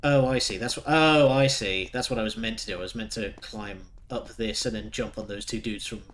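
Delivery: normal talking voice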